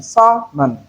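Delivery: Sermon for salmon, in two parts, SA-mon; silent L. Shown as a demonstration. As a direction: sound out Sermon as "sa-mon" without L. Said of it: "Look at the transcription".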